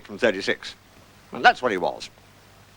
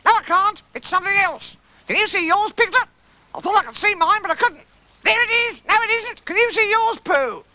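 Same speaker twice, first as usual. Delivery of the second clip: very high, excitable little voice; high-pitched childlike cries; Roo; 3 dB louder than usual. Acted. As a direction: chatter eagerly in a high squeal. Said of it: "cried Roo".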